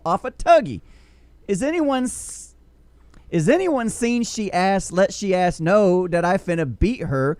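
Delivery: loud, slow, high angry rant. Pretend. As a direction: rant it like a furious woman calling someone out. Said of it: "I'm gonna give it a shot".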